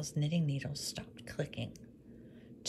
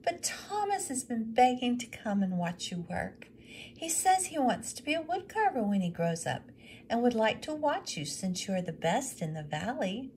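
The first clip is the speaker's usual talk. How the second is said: higher, polite request; widow's voice; 7 semitones higher, 3 dB louder than usual. Acted. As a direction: ask the favour politely. Widow asking on son's behalf.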